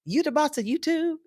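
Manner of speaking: put-on high, childlike awe; amazed girls. Girls meeting the YouTube vice president, high childlike awe. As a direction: sound like a starstruck kid. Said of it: "They're like".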